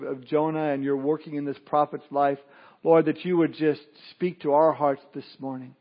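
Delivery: soft, low pastoral prayer voice. Acted. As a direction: pray gently for the congregation. Pastor leads opening prayer.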